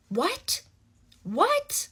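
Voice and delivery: high, loud disbelieving what; can't believe he did that; real stunned outrage; incredulous what. Real, not acted. Outraged disbelief at his demand.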